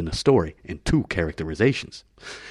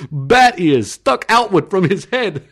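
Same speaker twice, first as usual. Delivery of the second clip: laughing; 9 semitones higher, 7 dB louder, slower than usual. Real, not acted. Laughs through the line.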